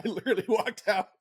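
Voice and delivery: high-pitched, talking through laughter; laughing. Real, not acted. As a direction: crack up while telling it. Laughing at the tear story.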